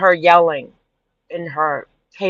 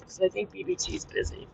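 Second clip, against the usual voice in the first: whispered aside; 15 dB quieter, faster than usual. Real, not acted. Aside at end of stream.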